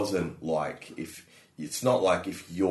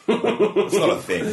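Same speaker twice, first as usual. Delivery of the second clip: laughing; 14 semitones higher, 9 dB louder, slower than usual. Real, not acted. Joking about childhood as a chore.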